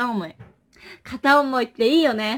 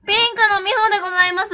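mock formal intro; 9 semitones higher, 7 dB louder, much faster than usual.

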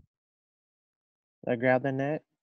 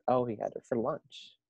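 usual speaking voice

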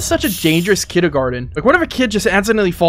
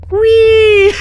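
high gleeful whee down the slide; excited squeal; 5 dB louder than usual. Real, not acted. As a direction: squeal with delight. Sliding down the slide.

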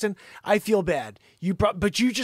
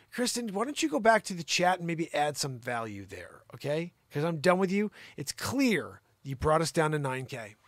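usual speaking voice

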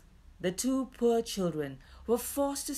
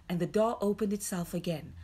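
plain reading voice

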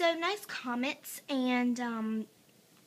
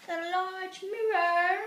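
higher, louder, slow showy announcement of a large mirror; a mock-grand reveal of the mirror; mock grand showcase; 6 semitones higher, 5 dB louder than usual. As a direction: unveil it like a game-show prize, drawn out. Showing off the bathroom mirror.

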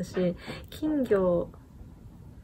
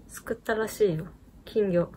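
usual speaking voice

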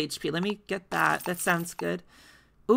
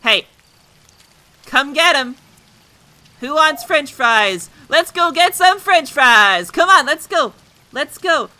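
calling out; 8 semitones higher, 8 dB louder than usual. Pretend. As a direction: hawk fries to a crowd. Calling customers as a fry vendor.

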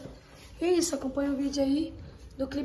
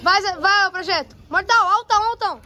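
yelling from the sidelines; 6 semitones higher than usual. Real, not acted. Cheering divers at pool.

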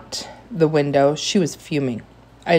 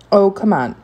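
raised, brisk brush-off; dismissive protest, a bit louder and quicker; 5 semitones higher, 5 dB louder than usual. A narrator's reading. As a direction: wave the objection away impatiently. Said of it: "Mister Tushman answered dismissively".

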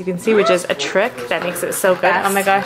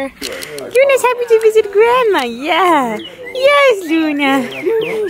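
high cooing voice for the puppy; high, sing-song pet talk; dog baby talk; 11 semitones higher, 4 dB louder, much slower than usual. Acted.

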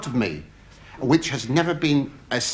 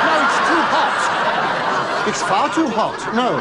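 higher, slow, breathy-laughing coy; camp mock coyness; 7 semitones higher, slower than usual. Comic answers the nurse's question coyly, higher and slow. Innuendo reply.

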